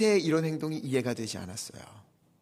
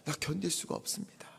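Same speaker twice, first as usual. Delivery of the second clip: exhausted; 10 dB quieter than usual. Retold or imitated. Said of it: "이렇게 고백해요".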